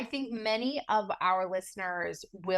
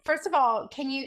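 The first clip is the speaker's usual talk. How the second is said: usual speaking voice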